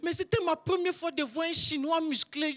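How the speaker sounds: ordinary talk, nothing marked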